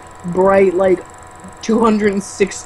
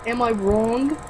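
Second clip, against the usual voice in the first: soft nasal New Jersey take; mild New Jersey accent, rising; 2 semitones higher, 5 dB quieter, slower than usual. Acted. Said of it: "my New Jerseyan voice".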